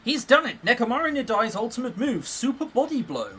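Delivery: high, hyped-up fight commentary; dramatic anime move call; excited announcing. Acted. Voices an excited game character.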